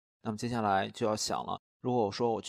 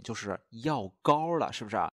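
normal talking voice

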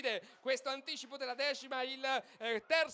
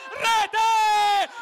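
goal shout; 12 semitones higher, 24 dB louder than usual. Real, not acted. Goal call repeated.

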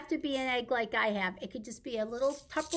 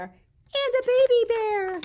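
much higher tiny-voiced baby bear; squeaky baby bear; 10 semitones higher, 6 dB louder than usual. Storyteller voicing little baby bear for the babies. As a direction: make it small and squeaky.

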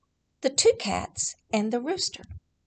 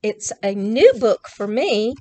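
plain reading voice

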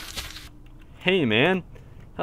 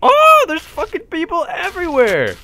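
amazed excited shout; 13 semitones higher, 8 dB louder than usual. Real, not acted. Amazed at crowded server.

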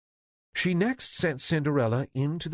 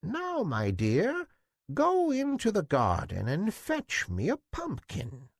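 fairy godmother; 9 semitones higher than usual. Acted. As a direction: promise it like a queen of fairies. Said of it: "The fairy took Cinderella by the hand and said".

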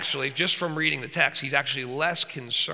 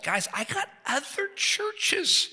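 much higher, louder, slow, hammed-up exasperation; Paul pleading he must move on; exasperated apostle voice; impatient Paul; 12 semitones higher, 6 dB louder than usual. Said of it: "Paul's like".

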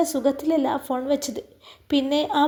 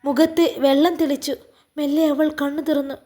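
plain reading voice

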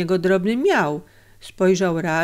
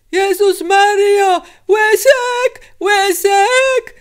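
high, loud, drawn-out cries for help; desperate high yelling; terrible scream; 13 semitones higher, 6 dB louder, slower than usual. A narrator's reading. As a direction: scream in terror for rescue. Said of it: "krzyknął okropnym głosem".

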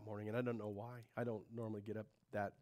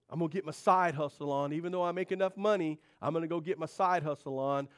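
louder boastful resolve; mock boast; 5 semitones higher, 9 dB louder than usual. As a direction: brag about the side hustle, half-mocking. Voicing a striving person.

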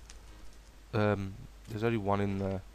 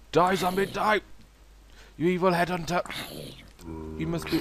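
battle cry; 6 semitones higher, faster than usual. Pretend. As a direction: yell it like charging into battle. Fighting mobs in game.

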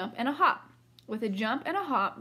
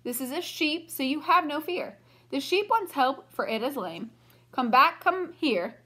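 calling out; 6 semitones higher, 5 dB louder, slower than usual. A narrator's reading. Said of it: "I called".

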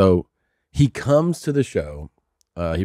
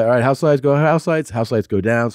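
normal talking voice

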